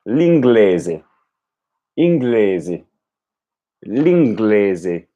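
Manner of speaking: L'inglese then inglese then L'inglese; alternating with and without L. Shown as a demonstration. Learner drilling the article, Italian with and without L. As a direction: switch the L on and off. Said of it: "with the L sound and without the L sound".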